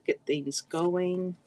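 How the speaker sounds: ordinary talk, nothing marked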